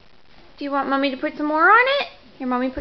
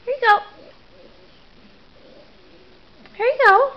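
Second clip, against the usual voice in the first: high sing-song offer; 7 semitones higher, faster than usual. Real, not acted. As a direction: coo it up high. Mother handing spoon, baby talk.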